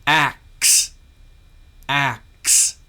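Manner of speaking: "axe" loud, apple vowel into a crisp ks; loud, carefully enunciated "axe"; dictated sound blend. Shown as a demonstration. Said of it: "short A sound pronounced followed by a consonant".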